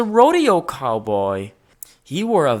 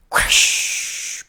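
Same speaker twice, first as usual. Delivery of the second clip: crash. A narrator's reading. Crash sound effect.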